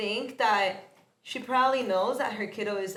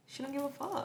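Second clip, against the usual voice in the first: sassy aside; 4 semitones higher, 11 dB quieter than usual. Acted.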